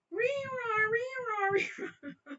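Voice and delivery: high, loud wailing siren noise; police siren imitation. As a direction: wail like a police siren, laughing at the end.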